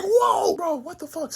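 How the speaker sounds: high yelped whoa; startled shout. Retold or imitated. Narrator startled by the threat, high yelp. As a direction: jump back startled. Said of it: "I'm like".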